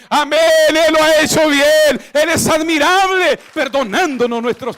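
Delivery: loud, rising sermon cry, repeating the refrain; impassioned shouted preaching; full-force preaching. Acted. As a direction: preach it out to the whole hall.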